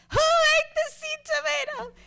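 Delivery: very high, drawn-out furious scream; retold enraged uncle's yell at full volume. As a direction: holler it high, loud and slow. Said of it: "comes in yelling and screaming".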